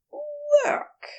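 high, tentative; Aziraphale guessing. Acted. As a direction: offer it as a guess.

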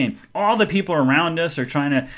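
ordinary talk

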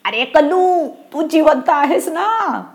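high-pitched, drawn-out call; anxious calling up. A narrator's reading. Damu calls up to Kallu in the tree, high anxious call. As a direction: call up to a friend, voice climbing. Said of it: "दामूने खालून ओरडून विचारलं".

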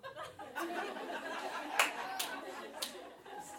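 silent wheezy laugh into the mic; can't hold the laugh in; real airy giggle; breathy laugh. Real, not acted. Laughing at the weird moment.

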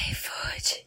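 breathy unvoiced whisper; whispered asmr ad. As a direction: whisper the brand name softly. ASMR iFood demo.

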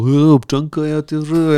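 mock a newcomer's Serbian-accented Czech; Serb speaking Czech. Father recalling his early accent in Czech, mock Serbian accent. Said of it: "takový to".

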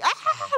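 a high imitated scream; mock scream. Imitating the ceremony participants, a high mock scream. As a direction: mimic the people screaming in the ceremony. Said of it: "screaming, like".

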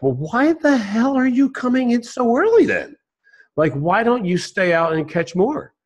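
high-pitched, baffled questioning; baffled businessman who can't make sense of it; completely confused. Acted. Businessman questions fisherman coming in early, baffled. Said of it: "with this completely confused expression".

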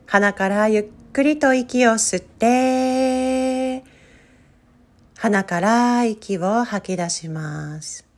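slow, calm meditation guide voice; guided meditation. Acted. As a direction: lead them gently into stillness. Leads a guided breathing meditation.

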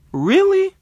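very high, loud squeak of disbelief; incredulous shriek. Retold or imitated. Indignant at "just a song.

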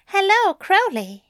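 bright prim angel greeting. Acted. Aziraphale voiced by narrator.

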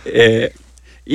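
ordinary talk

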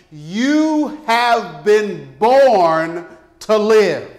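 slow, drawn-out proclamation; booming motivational speech. Acted. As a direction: preach each word slowly and firmly. Preacher proclaims the theme.

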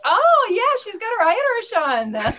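high, loud hammed-up reaction; mock impressed American. Cohost imitating Americans fooled by the accent, mock impressed.

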